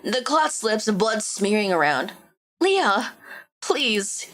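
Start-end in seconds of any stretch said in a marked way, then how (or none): none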